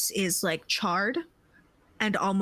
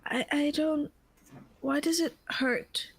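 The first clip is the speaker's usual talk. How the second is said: high, hurting and lost; a wounded woman who can't understand her pain; pained confusion; 7 semitones higher than usual. Acted. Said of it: "and she goes".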